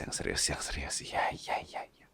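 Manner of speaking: soft unvoiced whisper; hushed playful whisper. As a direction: whisper it close and teasing. Teasing whisper to sick partner.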